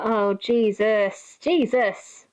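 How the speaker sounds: very slow, stretched Jesus in disbelief; slow incredulous groan; drawn-out exasperation. Retold or imitated. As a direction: drag it out, appalled. Exasperated retold reaction.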